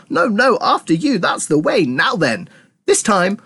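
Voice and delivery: high, gushing delight; thrilled, tumbling high-pitched chatter; bursting with excitement. A narrator's reading. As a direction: gush on, pitch swooping high. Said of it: "suddenly exploded with excitement".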